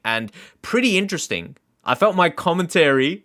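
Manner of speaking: normal talking voice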